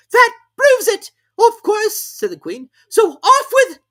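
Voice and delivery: very high, loud screeching queen. A narrator's reading. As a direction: shriek the sentence as the queen. Queen triumphant.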